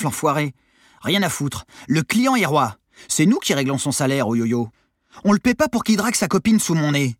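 high, loud indignant complaint; griping. A narrator's reading. Lazy crewmate griping about the monitor, indignant. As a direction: protest like a put-out customer. Armand grumbles about being towed.

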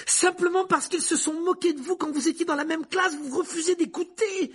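high, loud accusing shout; outraged cry. A narrator's reading. Boy accuses teacher of a grudge, shouting. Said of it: "s'écria alors Harry".

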